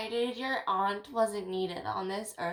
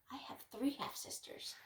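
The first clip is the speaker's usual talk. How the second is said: whisper; 18 dB quieter than usual. Real, not acted. Aside in a whisper.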